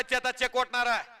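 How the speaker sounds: rousing announcer patter over the crowd; live commentary. Acted. Commentator hyping the crowd at the kusti ground, announcer patter.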